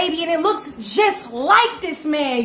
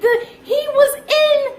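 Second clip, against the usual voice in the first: higher, slow disbelief; higher, slow incredulous reveal; 8 semitones higher, 3 dB louder, slower than usual. Real, not acted. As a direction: stretch out the punchline. Incredulous punchline about his teacher.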